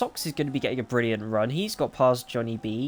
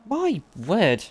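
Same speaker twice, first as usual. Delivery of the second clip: amazed exclamation; 7 semitones higher than usual. Real, not acted. Commentator amazed by race action.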